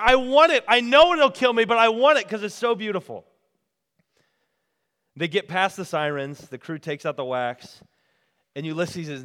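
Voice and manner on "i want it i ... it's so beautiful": high, loud desperate pleading; acted raving shout; mad screaming. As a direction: cry out madly with longing, easing slightly at the end. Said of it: "He's screaming and screaming".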